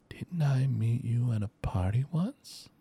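slow, unvoiced creature whisper; eerie whisper. Creature whispers to the three heroes, slow and eerie. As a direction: breathe it out slow and unsettling. Creature speaks creepily to the party.

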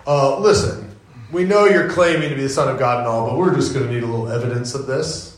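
mock Pharisees. Pharisees demanding proof from Jesus, smug and fast. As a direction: play the skeptics as smug and offhand. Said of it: "the Pharisees walk up to him and they go".

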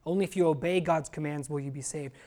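normal talking voice

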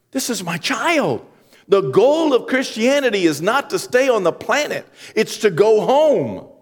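high, loud, building preacher voice; preaching at full force. Acted. Pastor preaching an Easter sermon to the congregation, at full force. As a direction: preach it out to the whole church.

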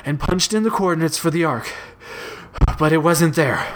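winded report in short bursts; out of breath. A narrator's reading. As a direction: pause for breath between phrases. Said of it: "still breathing heavily".

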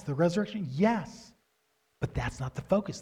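usual speaking voice

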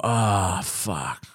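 low, dropping groan of dismay. Retold or imitated. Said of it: "And you go".

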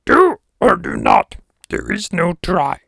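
high, slow, loud creature voice; hammed-up little sage, drawn out word by word; Yoda. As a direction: put on a slow, high-pitched sage's character voice. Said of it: "That is a voice I've done. I call him Yoda".

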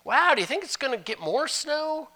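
high chirpy weather chat; idle small talk. Retold or imitated. Pastor mimics shallow weather talk, chirpy and high. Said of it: "more superficial than".

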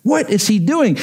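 mock-horrified kid's protest; Lulu. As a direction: act a child appalled at the mess. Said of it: "She said".

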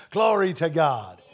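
slow, loud, drawn-out praise; praise shout. Real, not acted. Preacher praising God before congregation, slow loud shout. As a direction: shout praise, stretching each word out.

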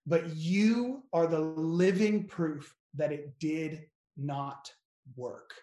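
slow, spaced-out blessing. Real, not acted. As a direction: say it slowly, pausing between phrases. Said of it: "I'll say it slow the first time".

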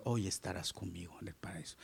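whispered, low and gentle promise; tender whisper. Acted. Jesus whispers to the dying thief, tender and hushed. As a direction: breathe the promise softly like Jesus.

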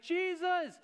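high, loud, overjoyed thanks; a healed leper loudly thanking Jesus, high and overjoyed; loud grateful cry. Retold or imitated. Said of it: "it says he's coming in a loud voice".

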